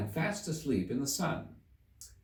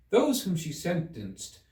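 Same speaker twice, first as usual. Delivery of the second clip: ordinary delivery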